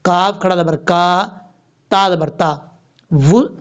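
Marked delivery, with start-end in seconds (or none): none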